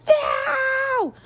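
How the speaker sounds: high sudden shout at the dog; sharp no. Retold or imitated. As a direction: stop the dog with one yelp. Yelling at dog licking herself.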